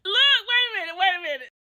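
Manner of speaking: high, giggling wait a minute; quoting Tammy while cracking up; laughing quote. Retold or imitated. Retelling Tammy through laughter, high and loud. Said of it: "So then Tammy says".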